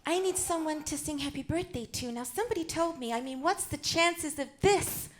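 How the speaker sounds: lightly voiced Hollywood starlet, lifted pitch; a hint of screen-siren impersonation; Marilyn Monroe. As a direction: play the starlet chatting to her audience.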